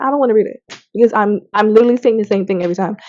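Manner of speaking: fast complaint. Retold or imitated. Vlogger retelling her Bible frustration, in a rushed gripe. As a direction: rattle it off in frustration. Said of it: "It was just like".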